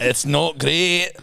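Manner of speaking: slow, drawn-out Scottish put-down; mock Scottish accent. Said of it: "not a bad Scottish accent".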